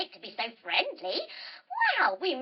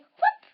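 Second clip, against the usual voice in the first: high, loud cartoon whoop; sudden squeaky jump noise; short high whoop of a hop; hopping whoop; 10 dB louder than usual. Acts out bunny hops.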